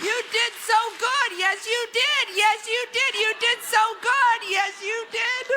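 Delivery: gushing pet-owner voice; praising a puppy. Retold or imitated. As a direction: coo at him like a good dog. Praising husband like a dog.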